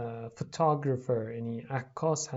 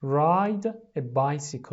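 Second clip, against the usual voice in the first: very slow, loud teacher model; slow model phrase; 4 dB louder, much slower than usual. Shown as a demonstration. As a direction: drag out each word for repeating. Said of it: "لطفاً تکرار کنید".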